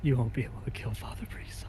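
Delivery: hushed, breathy whisper. Acted. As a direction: whisper it back, defiant. Threat scene, hushed reply.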